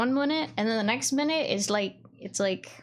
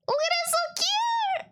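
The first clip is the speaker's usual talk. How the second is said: high excited squeal; faster than usual. Real, not acted. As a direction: squeal over something adorable. Squeal at cute thing.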